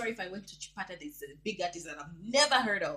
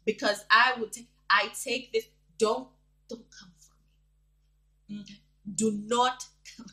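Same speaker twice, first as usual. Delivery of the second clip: imitating angry threat; 4 semitones higher, 8 dB louder than usual. Vlogger quoting Zagado's angry Facebook video, mock fury. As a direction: mimic a furious man laying down the law. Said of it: "he was like".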